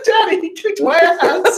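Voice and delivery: very high, drawn-out whine through laughter; hammed-up squeal to Daddy, cracking up; mock victim. Friend imagining her message to Daddy, mock victim.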